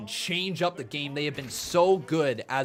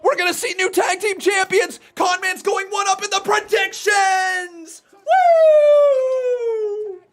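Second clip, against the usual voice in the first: high, loud cheering chant; excited shouting; 8 dB louder than usual. Real, not acted. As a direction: roar it like a fan. Cheering the title change live.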